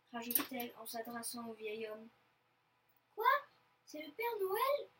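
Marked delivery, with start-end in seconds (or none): 3.1-4.9 high, rising question; young wolf's surprised, lifted voice; astonished. A narrator's reading. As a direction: ask it in amazement. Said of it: "s'étonne Loustic".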